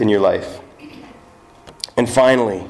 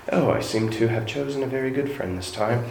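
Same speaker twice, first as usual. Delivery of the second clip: a deliberately bad, softer British accent; bad C S Lewis British accent; 7 dB quieter than usual. Preacher jokingly voices C S Lewis in a bad British accent. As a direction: ham up a poor British accent for a laugh. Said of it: "that's really bad British".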